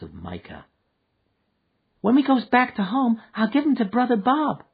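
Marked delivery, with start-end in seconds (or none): 2.0-4.6 put-on high, childlike voice of a small girl; a lost little girl asking after her mother. A narrator's reading. A small girl asking the stranded man for her mother.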